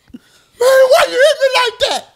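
high, loud mock whine through laughter; mock whiny complaint. Teller voicing the man he hit, high laughing whine. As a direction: whine it like a kid who got smacked.

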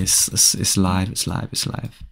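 hushed whisper. Real, not acted. Streamer telling chat he is live, whispering. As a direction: breathe it out under his voice.

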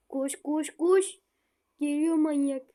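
panicked yelling while fleeing; being chased. Real, not acted. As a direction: shout it in a frantic run. Fleeing monster in game.

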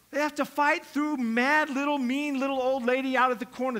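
ordinary delivery

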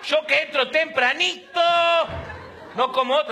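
mock shouting wife. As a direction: play the wife broadcasting so he wakes up. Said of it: "pero a los gritos".

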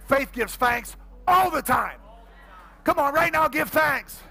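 loud, high rallying call; full-force preaching. Real, not acted. Pastor urges church to give thanks now, preaching forcefully. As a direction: shout the congregation into praise.

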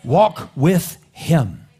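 very slow, loud and drawn out; slow urging. Real, not acted. Preacher urging listeners, slow and loud. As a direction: stretch each word out as a plea.

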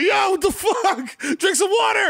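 high, stretched-out mock yell; panicking kidneys. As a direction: cry out like frantic organs. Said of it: "Your kidneys are running around like".

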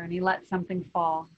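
usual speaking voice

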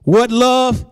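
high, insistent teaching sermon; emphatic preaching. Real, not acted. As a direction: build the points with pitch held high. Preacher builds up the list.

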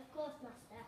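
low, soft obedient robot reply; polite butler robot. Acted. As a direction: answer like a dutiful robot servant. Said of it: "Robot Maid three thousand".

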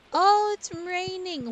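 loud, high surprised cry. Real, not acted. Player sees rain start in her farm game, loud surprise. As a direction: blurt it out as the storm hits.

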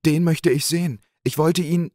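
hoch und laut, zornig aufbrausend; auffahrend. A narrator's reading. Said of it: "fuhr der Wirt auf". (Wirt fährt zornig gegen den unbekannten Gast auf)

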